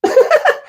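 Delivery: high breathy giggle before answering; imitated giggle. Comic imitating his date's nervous giggle. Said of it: "She would answer just like this".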